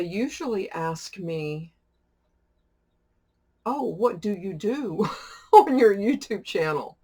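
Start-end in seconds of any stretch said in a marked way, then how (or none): 3.7-7.0 louder, higher mock-curious question with a laugh; hammed-up curiosity, breaking into laughter; curious questioner. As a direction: imitate a nosy asker, lifted and loud. Voicing people's curious question, laughing.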